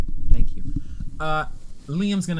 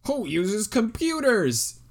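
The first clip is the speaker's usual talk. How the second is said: mocking voice; 8 semitones higher, 6 dB louder, much slower than usual. Mocking old show.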